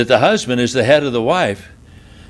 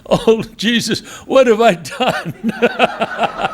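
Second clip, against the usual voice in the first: high, slow imitated sob with a laugh in it; wife's hammed-up sobbing cry; mock sobbing wail; 9 semitones higher, much slower than usual. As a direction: playfully imitate the wife crying out. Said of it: "she starts sobbing".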